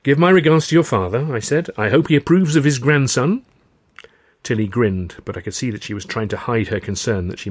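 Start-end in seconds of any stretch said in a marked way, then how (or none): 0.0-1.3 higher, louder farewell; hearty send-off. Acted. Sending off partner at station. 1.8-3.4 higher, louder farewell; hearty send-off. Acted. Sending off partner at station.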